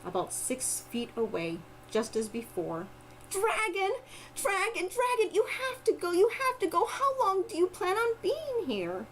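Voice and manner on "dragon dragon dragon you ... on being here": put-on little girl voice. Girl urging dragon.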